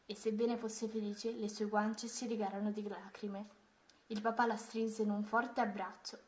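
usual speaking voice

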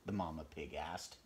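ordinary delivery

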